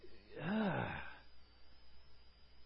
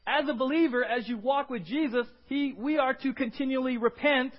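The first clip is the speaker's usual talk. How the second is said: fired-up preaching; 8 semitones higher, 6 dB louder than usual. Acted. Preaching at full force.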